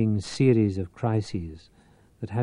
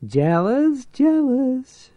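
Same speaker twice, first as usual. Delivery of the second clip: high, rising squawk, louder each time; 4 dB louder, much slower than usual. A narrator's reading. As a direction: screech it like a parrot. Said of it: "she squawked".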